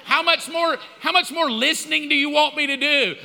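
high-pitched, defensive comeback; exasperated protest. Retold or imitated. Husband defending his nine minutes of listening, exasperated. Retold defensive reply to wife.

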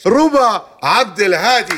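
slow, loud, high name call; booming prize announcer. Acted. (إعلان الاسم بصوت مدوٍّ)

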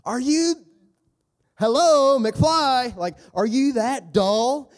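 very high, loud mock exasperation; holy sass. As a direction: shout it like knocking on a thick head. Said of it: "I could just see him saying that".

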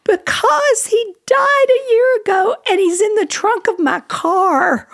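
high distraught outburst, slowing at the end; hammed-up, wailing retelling; imitated upset wail. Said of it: "why are you so upset about this".

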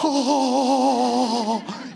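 long airy exhaled whoosh; tumbleweed wind noise. Retold or imitated. Mimicking the awkward tumbleweed moment for the audience, long airy whoosh. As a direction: imitate an empty wind blowing.

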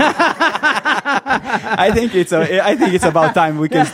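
high, loud laughing speech. Real, not acted. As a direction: get it out through big laughter. Laughing, ending the interview.

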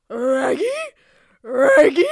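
loud cartoon dog calling out; Scooby-Doo. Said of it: "I'm doing, uh, Scooby-Doo".

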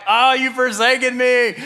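hammed-up despairing cry to God, high; comic high-pitched lament; freaking out. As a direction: wail it in mock panic. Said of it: "I'm, like, freaking out".

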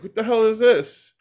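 high-pitched mock bafflement; retold disbelief. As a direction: act out being taken aback. Retelling own shocked reaction to sign.

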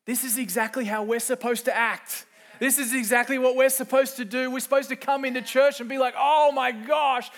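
high and loud, fired-up joy; excited shout. Real, not acted. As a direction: shout it like you can't believe your luck. Preacher urging excited worship.

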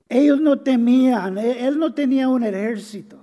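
impassioned pulpit preaching; fiery preaching. Real, not acted. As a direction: build it up and proclaim it to the congregation. Preacher builds to climax.

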